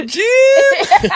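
hammed-up helpless cry for help; Tony Romo impression. Mocking Tony Romo on air calling out to Jim for help. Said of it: "Tony Romo on air".

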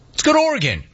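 high, loud call to the next caller; announcer call. Real, not acted. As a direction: belt out the caller's state.

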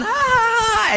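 put-on high yelps of fleeing kids; mock panicked yelps. Boys fleeing their angry mother, high panicked yelps. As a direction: squeal like a kid running for his life.

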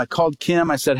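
ordinary talk

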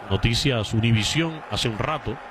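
usual speaking voice

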